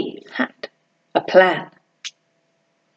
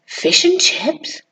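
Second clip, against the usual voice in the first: intrigued; 4 semitones higher, 3 dB quieter, slower than usual. Acted. Greedy perks up at food.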